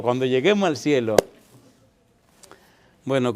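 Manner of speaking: ordinary talk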